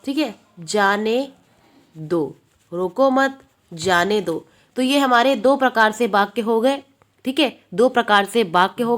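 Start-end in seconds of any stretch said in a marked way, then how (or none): 0.6-2.4 जाने held up, a pause, then दो dropped low; second clause apart. Shown as a demonstration. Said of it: "comma लगा दिया हमने". 2.7-4.5 रोको मत together, short break, जाने दो falling lower; pause after मत. Shown as a demonstration. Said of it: "comma लगा दिया हमने".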